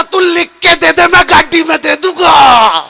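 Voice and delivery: high protest swelling to a long last word; exasperated outburst. Acted. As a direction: cut in, fed up and loud. Burdened man snaps at advice. (झुंझलाकर ऊँची आवाज़ में)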